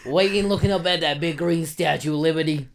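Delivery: loud, high New Yorker storytelling; big comic New York voice; New York accent. Acted. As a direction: reminisce as a hammed-up New York immigrant. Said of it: "I can do these voices".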